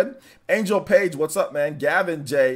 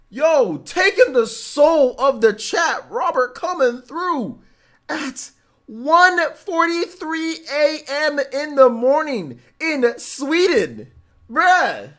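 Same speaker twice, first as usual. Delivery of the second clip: loud, high, slow drawn-out hype; hyped announcing; 10 semitones higher, 5 dB louder, slower than usual. Real, not acted. Streamer hyping a friend joining from Sweden, excited announcing. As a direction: stretch each phrase like a big entrance announcement. Hyping Robert's late-night arrival.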